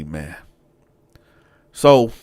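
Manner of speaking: ordinary talk, nothing marked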